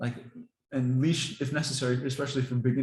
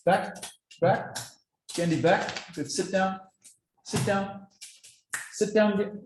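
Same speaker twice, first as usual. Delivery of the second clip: dog commands; 7 semitones higher, slower than usual. Real, not acted. Training dogs before feeding.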